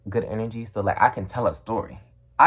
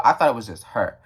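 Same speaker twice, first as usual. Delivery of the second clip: ordinary delivery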